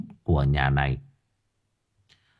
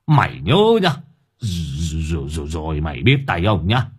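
put-on an angry old grandfather grumbling; grumbling old man; 4 semitones lower than usual. A narrator's reading. Old man grumbles threats at the runaway son, fuming. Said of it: "cứ đứng đấy mà cằn nhằn".

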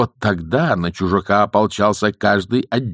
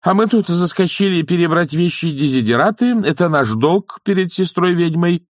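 high, raised declaring voice; loud announcement; 10 semitones higher, 4 dB louder than usual. A narrator's reading. Old witch explains their presence to the newcomer, announcing loudly. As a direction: announce it for all to hear. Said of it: "громко объявила матушка Буревей".

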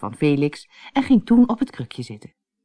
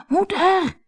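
very high, drawn-out call; somewhat louder call. A narrator's reading. Anneke tries again to get mother's attention, higher insistent call. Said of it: "riep Anneke nu wat harder".

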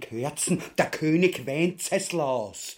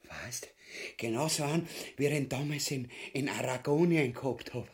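dreamy musing; 4 semitones lower, 6 dB quieter than usual. Acted. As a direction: drift off into a greedy dream. Ubu daydreams of riches.

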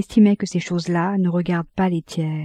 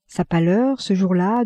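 usual speaking voice

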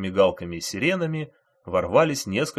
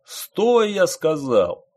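high, loud shouted order; acted bellow, pitched well up; yelling; 7 semitones higher, 5 dB louder, much slower than usual. A narrator's reading. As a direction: yell it as a furious command. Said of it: "заорал водитель".

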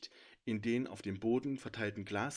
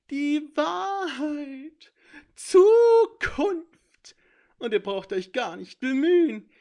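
high, loud, gleeful creature voice; a smug little thief lecturing from the shadows; high sly intruder voice; 16 dB louder, slower than usual. A narrator's reading. Sneaky intruder boasting to the heroes, high and gleeful.

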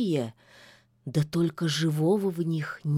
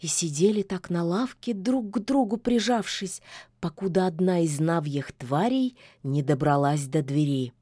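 ordinary delivery

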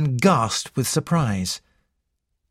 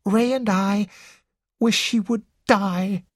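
put-on high, timid young girl; 8 semitones higher than usual. Girl's voice in read story.

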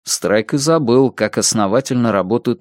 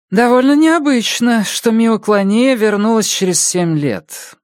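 much higher, louder doctor voice; brisk questioning doctor; female doctor voice; 11 semitones higher, 3 dB louder than usual. Acted.

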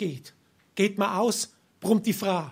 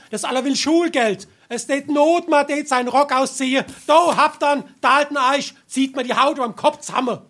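louder and higher, ranting at the burdens; building rant; 6 semitones higher, 6 dB louder than usual. Acted. Fed-up husband rants about his family's demands, louder and louder. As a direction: work yourself into a rage.

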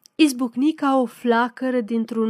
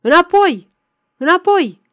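high, loud, forceful command; shouted order; 7 semitones higher, 9 dB louder than usual. A narrator's reading. Gendarmes to the surging crowd, shouted order. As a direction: yell to drive the crowd back. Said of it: "țipau jandarmii".

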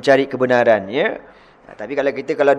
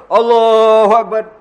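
higher, louder Allahu Akbar tossed off; hasty takbir; 7 semitones higher, 5 dB louder, slower than usual. Shown as a demonstration. Preacher mocking a latecomer's rushed takbir, higher and louder. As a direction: fling out Allahu Akbar then rush. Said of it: "orang masuk-masuk".

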